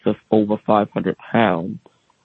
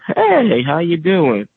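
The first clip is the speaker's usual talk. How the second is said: high cheery phone greeting from father; chirpy hello down the line; father answering the phone, high cheerful greeting; cheerful dad; 7 semitones higher, much slower than usual. Retold or imitated.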